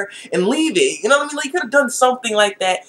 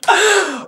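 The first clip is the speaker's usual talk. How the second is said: much higher burst of laughter; shrieking laugh; 13 semitones higher than usual. Real, not acted. Reacting to the anime scene with a high burst of laughter.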